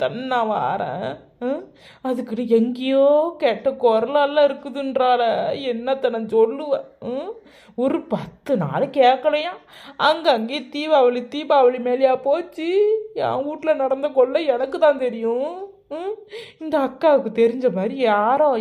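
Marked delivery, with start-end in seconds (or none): none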